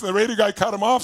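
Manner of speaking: ordinary delivery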